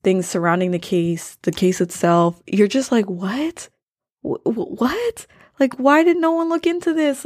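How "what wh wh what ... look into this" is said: stammer, then jump high in disbelief; baffled, high-pitched protest; incredulous disbelief. Acted. Podcaster voicing a stunned listener's reaction, incredulous.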